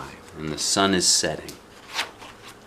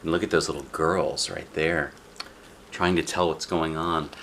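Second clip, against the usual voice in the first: plain reading voice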